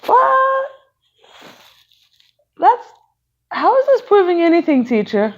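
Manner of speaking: high, loud confused shout; baffled outcry. Acted. Class puzzled by teacher's folding, loud and high. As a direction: protest in disbelief. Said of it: "class is kinda looking at her like".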